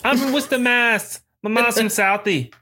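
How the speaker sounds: high-pitched, hammed-up Boston accent; bad Boston accent. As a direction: insist in a badly faked Boston accent. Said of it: "sound like you're not even from this country".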